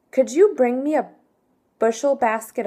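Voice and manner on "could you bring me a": ordinary talk, nothing marked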